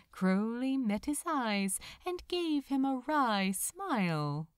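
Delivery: ordinary delivery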